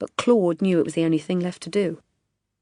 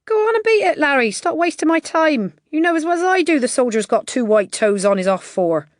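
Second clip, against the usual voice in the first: higher, louder, impatient race boss; brusque bookie; 9 semitones higher, 7 dB louder than usual. A narrator's reading. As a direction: shoo him off, high and loud. Feezy voiced by narrator.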